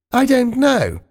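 put-on high, genteel old lady voice; the Queen. The Queen offering her saddle to Spiggy, high genteel voice.

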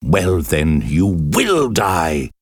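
slow, menacing shout; angry exclamation. A narrator's reading. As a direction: spit the threat slowly. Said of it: "he exclaimed angrily".

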